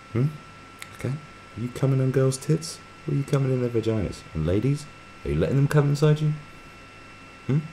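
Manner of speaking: high, sneering questions fired at chat; mocking interrogation. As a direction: needle them like a smug quizmaster.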